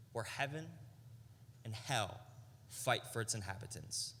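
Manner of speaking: low, hushed preaching with long pauses; solemn sermon. Acted. Preaching, slow dropped voice.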